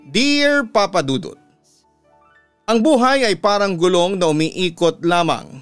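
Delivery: usual speaking voice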